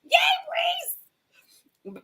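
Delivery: very high squeaky cheer; playful falsetto hurrah, drawn out; put-on cheering voice. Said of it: "Not in that voice, though. That's not Barry's voice".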